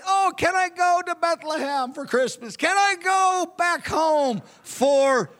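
imitating David begging to go home; earnest plea. [name] mimicking David begging his father. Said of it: "David asked me earnestly".

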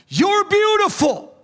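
high, slow, awed praise; adoring. Retold or imitated. Bride praising her beloved king, high and slowly awed. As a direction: gasp it out in wonder. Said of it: "she goes".

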